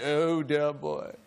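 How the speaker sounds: slow, drawn-out British thespian; imitating a British actor, taking his time; British accent. Sonnet reader answering an admirer, slow British accent. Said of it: "And he said".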